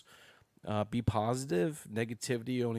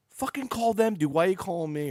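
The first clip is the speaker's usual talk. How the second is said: much higher and louder annoyed snap; mock yell; 10 semitones higher, 10 dB louder than usual. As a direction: play the fed-up friend yelling. Friend Jeff scolds him on phone.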